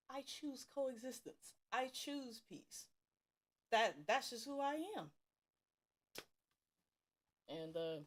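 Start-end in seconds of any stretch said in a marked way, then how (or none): 0.1-2.9 softer and slower, mock serene. Real, not acted. Telling the audience she chooses peace, soft and slow. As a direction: say it like a peaceful mantra.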